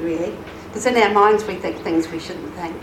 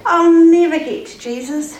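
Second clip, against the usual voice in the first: despairing wail; 6 semitones higher, 4 dB louder than usual. Acted. Man who couldn't walk despairs.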